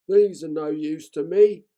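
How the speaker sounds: a cheerful troll consoling himself. A narrator's reading. Troll voice in read story.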